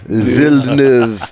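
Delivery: Villeneuve drawn out slowly in a mock French accent, vil-nuv; terrible French accent. Shown as a demonstration. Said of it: "That's a terrible French accent".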